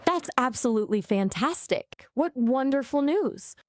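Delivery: high-pitched, put-on delight; upbeat. Shown as a demonstration. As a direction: gush over great news. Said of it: "I can do upbeat too".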